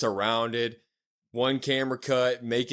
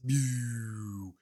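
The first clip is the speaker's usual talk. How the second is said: drawn-out "pew" effect; imitated power-down sound; 4 dB quieter than usual. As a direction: voice the lights zapping out in one long glide. Imitates the lights going out.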